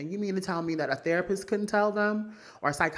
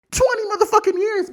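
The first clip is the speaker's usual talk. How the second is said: loud rant; 7 dB louder than usual. Real, not acted. Ranting at Khloe.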